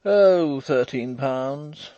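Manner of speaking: loud, high "oh", then drawn out slowly; careless old man. A narrator's reading. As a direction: toss off the sum carelessly. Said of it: "answered the old man carelessly".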